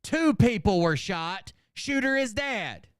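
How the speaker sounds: high, slow, declaimed read-out in separate chunks; mock haiku recital. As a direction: declaim each short phrase slowly with a pause after it.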